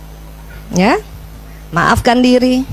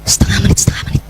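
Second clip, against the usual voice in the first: whispered reminder. Retold or imitated. Reminding self of time.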